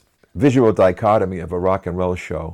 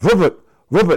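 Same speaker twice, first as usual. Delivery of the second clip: very high, loud, startled waking cry; 13 semitones higher, 6 dB louder than usual. Retold or imitated. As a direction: jolt awake and shout confused. Woken up suddenly, confused.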